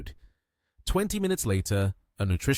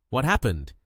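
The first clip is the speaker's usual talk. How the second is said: plain reading voice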